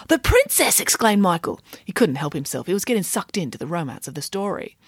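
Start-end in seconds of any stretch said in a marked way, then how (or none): 0.1-0.8 blurted out high; eager gasp of delight; excited. Acted. Michael bursts out excitedly.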